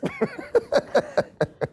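high-pitched burst of laughter. Real, not acted. Preacher laughing at his own joke, a high squeaky laugh.

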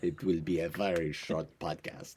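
put-on joking French-accented English; mock French accent for a laugh. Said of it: "podcast with a French accent".

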